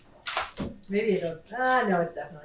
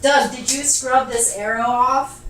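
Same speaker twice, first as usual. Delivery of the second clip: exasperated; 5 semitones higher, 8 dB louder, slower than usual. Real, not acted. Frustrated search for arrow.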